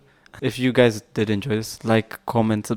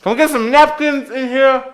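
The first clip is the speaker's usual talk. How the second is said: high, loud call to the crew; 13 semitones higher, 6 dB louder than usual. Pretend. Host calls for napkins for his sweating guest, calling out. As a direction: shout the request across the room.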